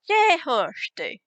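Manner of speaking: drawn-out slow version of the phrase; slow careful demo. Shown as a demonstration. Teacher showing the full slow form before speeding it up. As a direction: stretch it out slowly. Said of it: "Or you can speed that up".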